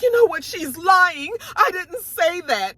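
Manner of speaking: high-pitched mock tearful denial; near tears. Candace denying it to the table, mock tearful. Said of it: "And she starts crying".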